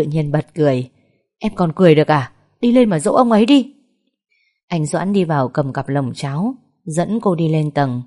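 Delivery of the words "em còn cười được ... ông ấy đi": scolding. A narrator's reading. Man scolding laughing Vân.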